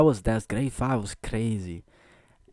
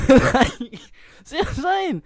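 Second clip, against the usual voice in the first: laughing; 7 dB louder than usual. Real, not acted. Laughs inside the quote.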